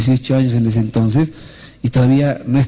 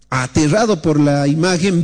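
ordinary talk

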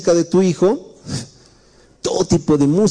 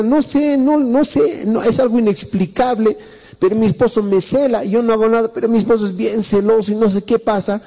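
higher, lightly put-on whiny wife; complaining wife; 6 semitones higher than usual. A preacher voices a wife telling the pastor her husband is jealous, higher and mildly whiny. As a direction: play a flustered wife who can't explain.

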